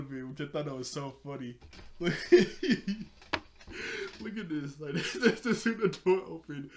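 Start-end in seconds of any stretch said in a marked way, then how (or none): 1.8-6.7 real laughter pushing the words up louder; tickled and stuttering over the words; laughing while talking. Real, not acted. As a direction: stumble through it, still cracking up. Still laughing at the scene.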